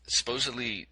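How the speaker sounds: normal talking voice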